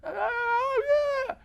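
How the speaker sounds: very high wailing yell; long, high-pitched acted wail; distant yell for help. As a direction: imitate a far-off cry for help. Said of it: "a voice comes out and yells".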